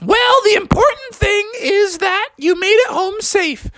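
very high scolding mom voice; stern mom. Acted. Mom telling Froggy to pay for the hole, very high scolding. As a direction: lay down the punishment in a high mom voice.

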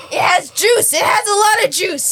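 loud, high excited yell; excited shout. Real, not acted. Reacting to pickle juice.

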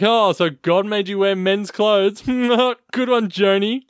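high, jeering imitation with a laugh; mocking. Men making fun of Joan's clothes, high mocking laughter. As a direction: tease her like one of the sneering men. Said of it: "keep making fun of her".

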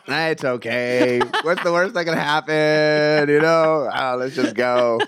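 slow, drawn-out coaxing; lazily elongated reassurance; acted carefree shrug-off. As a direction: stretch the words out, waving off the danger. Waving off the missing life vest.

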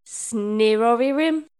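loud, drawn-out garbled nonsense; slow, loud reversed-speech gibberish; backwards-speech imitation. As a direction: sound like a tape played backwards, slow and loud. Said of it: "you sounded like this".

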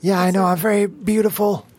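put-on high, vain old dwarf woman. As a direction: preen like a proud old dwarf.

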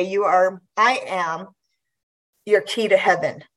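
ordinary delivery

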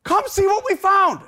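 put-on high, loud, eager child shout; kids yelling. Sons urging Dad to see their find, excited yelling. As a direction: holler it like an excited little kid. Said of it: "they're yelling to me".

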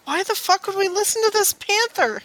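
incredulous. Acted. In-character outraged protest.

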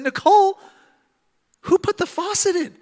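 high-pitched, incredulous demand; hammed-up outraged squawk at his wife; mock indignant disbelief. Retold indignant reaction to installed faucet.

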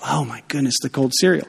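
a tired grandma rolling her eyes; grandma exasperated. Retold or imitated. Grandma mocking kids' cereal order.

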